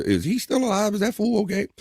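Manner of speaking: high, soft mock voice asking about the co-host; mock worried people. Host mimics chat wondering whether co-host is alive, mock high voice. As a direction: squeak it out like gossiping worriers. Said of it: "people go".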